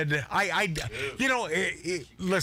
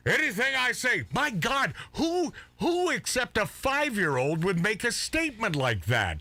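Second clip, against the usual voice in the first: exasperated disbelief; 7 semitones higher than usual. Real, not acted. Mocking the co-host's remark.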